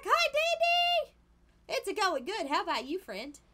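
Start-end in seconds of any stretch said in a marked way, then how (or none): 0.0-1.1 high, loud, drawn-out hi; excited greeting. Real, not acted. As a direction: greet a friend with delight. Greeting a returning friend.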